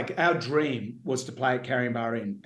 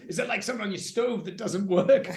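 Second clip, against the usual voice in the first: joking Swanee; 6 semitones higher than usual. Retold or imitated. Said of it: "He goes".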